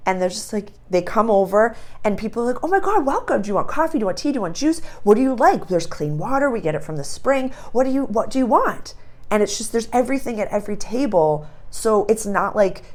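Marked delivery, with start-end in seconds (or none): 2.6-8.9 high, bubbly hostess offering drinks; eager welcome. Retold or imitated. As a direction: fuss over the guest happily. Picnickers welcome a newcomer.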